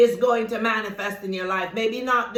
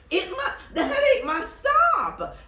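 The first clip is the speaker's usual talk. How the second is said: much higher, laughing as she commands it; high excited command; 14 semitones higher than usual. Real, not acted. To the headache, a high, laughing command to stop. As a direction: break into a high, laughing decree that it must stop.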